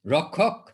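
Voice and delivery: Rakshak as rokok, no sh; Bengali-style Rakshak; Bengali pronunciation. Shown as a demonstration. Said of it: "it is the Bengali spelling".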